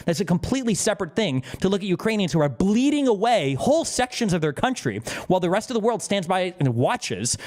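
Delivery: ordinary talk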